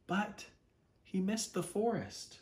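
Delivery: usual speaking voice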